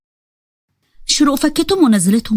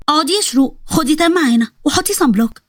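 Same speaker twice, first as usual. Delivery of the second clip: usual speaking voice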